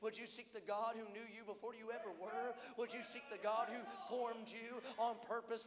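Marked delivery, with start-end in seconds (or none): none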